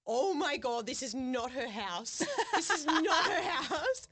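loud, slow, laughing through it; horrified realisation, giggling; laughing panic. Retold or imitated. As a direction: crack up as it dawns on you. Realising wrong house, laughing.

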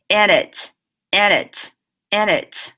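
and it run together; linked and it drill; linking and it. Shown as a demonstration. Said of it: "连起来".

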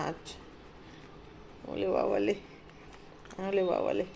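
soft, low cooing. Real, not acted. Cooing to the dog.

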